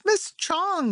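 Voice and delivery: put-on boy calls out to his teacher at the door; shouting. A narrator's reading. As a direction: call out to her, urgent. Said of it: "he shouted".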